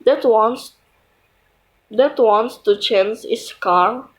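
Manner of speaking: ordinary talk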